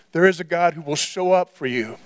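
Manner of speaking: usual speaking voice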